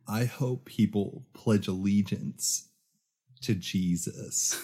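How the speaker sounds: quiet, measured impersonation through a smile; Jake. Acted. Podcast host imitates their first guest's sound bite, slow smiling impression. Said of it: "do my best Jake voice".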